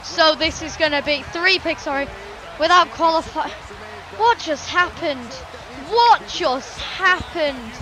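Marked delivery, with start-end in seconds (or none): 5.9-7.7 very slow, disbelieving question; stunned, drawn-out. Acted. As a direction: stretch out each word of "What just happened?".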